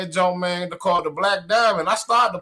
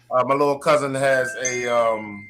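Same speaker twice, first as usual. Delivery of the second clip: usual speaking voice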